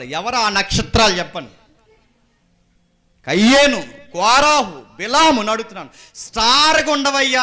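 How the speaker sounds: loud, high, emphatic sermon delivery; full-force preaching. Acted. Preacher asking the church about fallen stars, at full force. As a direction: hurl each name at the congregation.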